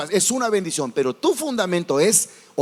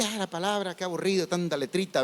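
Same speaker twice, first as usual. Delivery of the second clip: softer, lazily dismissive mock complaint; mock bored sigh; 8 dB quieter than usual. Preacher mocking bored listeners, softer whining. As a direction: play the churchgoer bored by scripture.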